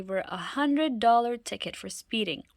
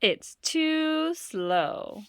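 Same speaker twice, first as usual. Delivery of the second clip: very slow, higher delivery; drawn-out punchline; 8 semitones higher, much slower than usual. Acted. Loretta delivering the twist to her parents, very slow. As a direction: savor the punchline slowly.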